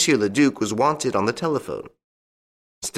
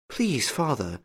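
softer, higher, hesitant plea; timid plea; 6 semitones higher, 5 dB quieter, slower than usual. A narrator's reading. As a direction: venture it gently to an angry father. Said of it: "Angélique ventured to say".

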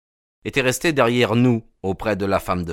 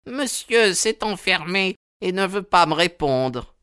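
very high woman's character voice; worried servant in falsetto; distraught old housekeeper; 10 semitones higher than usual. A narrator's reading. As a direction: explain fretfully, way up high.

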